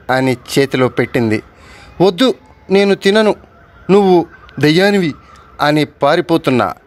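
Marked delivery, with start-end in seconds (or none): 2.0-5.1 frightened refusal. Acted. Girl refusing ghost in dream.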